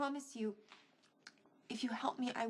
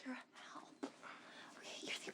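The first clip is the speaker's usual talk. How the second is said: pleading whisper; 11 dB quieter than usual. Acted. Begging Johnny for help.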